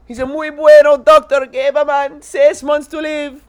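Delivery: hammed-up Spanish accent. Cheesy comic telling a podcast host his jokes, in a hammed-up Spanish accent.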